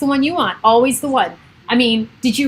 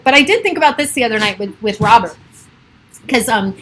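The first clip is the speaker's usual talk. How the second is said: ordinary delivery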